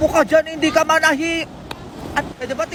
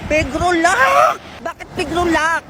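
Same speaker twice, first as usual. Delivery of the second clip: shocked exclamation; 4 semitones higher, slower than usual. Real, not acted. Vlogger reacting to an odd word, shocked exclamation. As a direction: blurt it out in shock, rising.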